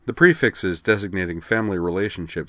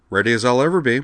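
wry; 5 semitones higher, 5 dB louder, faster than usual. Acted. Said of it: "Utena replied wryly".

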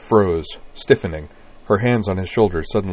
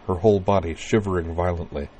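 ordinary delivery, nothing marked

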